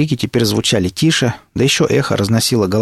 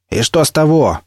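higher-pitched retort, mildly shouted; shouting; 6 semitones higher than usual. A narrator's reading. Никита answers the stalker's taunt, a mild shout. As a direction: throw it back across the gap. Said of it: "крикнул Никита".

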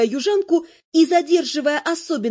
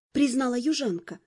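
plain reading voice

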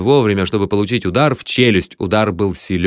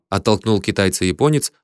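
plain reading voice

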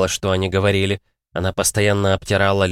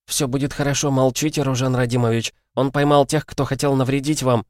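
anxious reassurance; 6 semitones higher than usual. Acted. Mikhli calms wounded prince.

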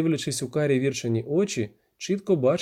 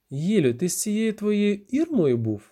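slow, high accusing question; jealous accusation; 7 semitones higher, slower than usual. Acted. Віра asking if he was with Ірма, accusing. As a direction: draw out the suspicion at a high pitch. Ревниве запитання Віри.

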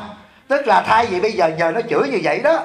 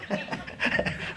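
soft laugh; 10 dB quieter than usual. Real, not acted. Laughs about gout.

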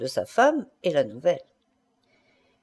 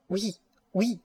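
small, softer stifled "oui"; choking stammer; 7 dB quieter than usual. A narrator's reading. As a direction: stammer it out as if choking back laughter. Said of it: "elle balbutia en étouffant". (balbutié en étouffant)

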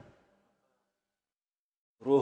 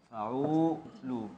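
drawn-out foot; 6 dB quieter, much slower than usual. Shown as a demonstration. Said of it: "teacher drags out foot".

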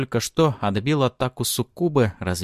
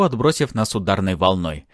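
usual speaking voice

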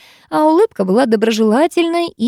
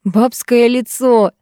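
guffawing; 4 semitones higher, 4 dB louder, much slower than usual. A narrator's reading. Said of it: "заржал кто-то сзади".

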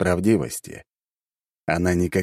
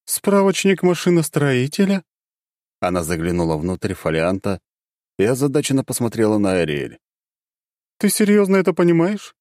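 high, baffled question; incredulous dark goddess; puzzled goddess; 7 semitones higher than usual. Acted.

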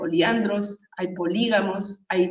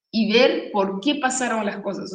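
normal talking voice

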